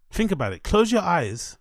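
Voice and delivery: ordinary talk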